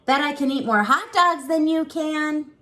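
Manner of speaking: high, loud boastful teasing; excited teasing brother. Acted. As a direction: brag to your little sister. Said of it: "he teased".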